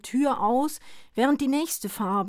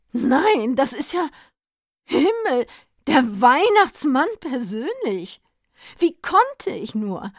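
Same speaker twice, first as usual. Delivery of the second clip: excited stammering; 6 semitones higher, 6 dB louder than usual. Acted. Druggist stammers at the soaked Santa, excited and loud. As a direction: fall over your words in shocked pity. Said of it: "stammelte er".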